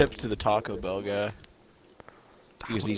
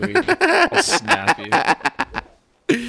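laughing shout; 14 semitones higher, 8 dB louder than usual. Real, not acted. Laughing threat over bench claim.

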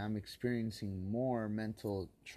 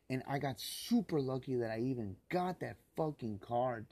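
real rage; 4 semitones higher than usual. Real, not acted. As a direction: hurl the names with real hatred. Furious rant about stolen card.

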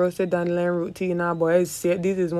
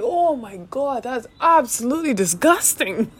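disgusted; 6 semitones higher, 3 dB louder, slower than usual. Retold or imitated. Retold disgust at the doctor.

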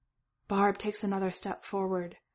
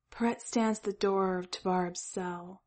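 usual speaking voice

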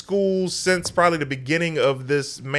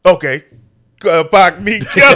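much louder imitated outburst, half-laughing at the end; mock outburst; 3 semitones higher, 10 dB louder than usual. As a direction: belt out the retold line loudly. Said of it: "And then you were like".

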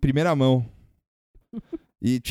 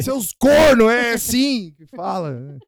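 mock listener voice; 10 semitones higher, 6 dB louder, much slower than usual. Voicing the listener's audio.

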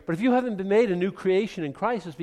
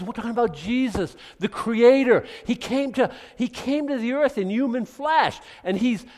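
normal talking voice

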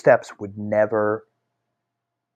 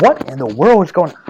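baffled outburst, higher and louder; retold alarm; 6 semitones higher, 6 dB louder than usual. Storyteller recalling being alone with ripped pages, alarmed bafflement. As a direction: blurt it out, bewildered. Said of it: "I'm like".

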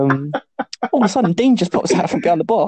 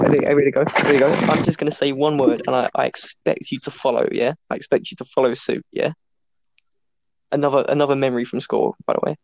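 ordinary talk, nothing marked

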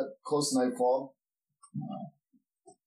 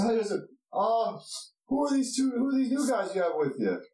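high-pitched guard asking after newcomers; friendly gatekeeper, voice lifted; inquisitive dwarf guard; 9 semitones higher than usual. Acted. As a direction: pitch it high and question the strangers.

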